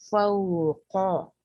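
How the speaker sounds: फौका drawn out very slowly, rounded lips on फौ; slow recitation demo. Shown as a demonstration. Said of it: "क आप बिल्कुल अंत तक जाएंगे".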